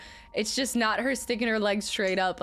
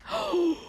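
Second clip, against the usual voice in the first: gasp; 11 semitones higher than usual. Real, not acted. Spotting a strong enemy.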